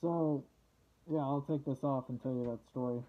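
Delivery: muffled behind rubber mask; speaking into mask filter; through a gas mask. Real, not acted. Seen 2: Full rubber gas mask with filter worn over face throughout.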